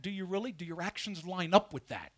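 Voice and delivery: ordinary talk, nothing marked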